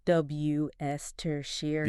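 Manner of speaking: slow "W S Tertiary", wuss-ter-sheer; correct pronunciation. Shown as a demonstration. Host repeating the correction, slow correct pronunciation. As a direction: draw out "W S Tertiary" syllable by syllable. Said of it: "But it's actually".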